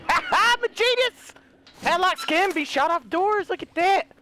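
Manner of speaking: high, crowing boast; delighted self-congratulation; triumphant. Real, not acted. Triumph after shooting padlock open.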